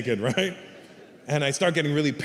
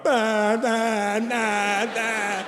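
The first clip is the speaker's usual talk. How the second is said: blurted nonsense noises; 7 semitones higher, much slower than usual. Retold or imitated. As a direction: spill out unfiltered babble. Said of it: "as soon as I walk up".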